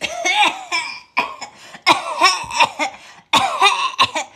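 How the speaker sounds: loud, high hacking cough, mimicked; imitating coughing man. Mimicking the passenger behind her on the flight, loud high coughs. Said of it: "gentleman behind me who was like".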